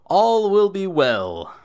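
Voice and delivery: slow, high and loud grand pronouncement; Baron's proclamation. Acted. Baron raising his arms to the crowd, grand slow proclamation. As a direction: proclaim it to the crowd like a pompous lord. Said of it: "upreach his arms and go".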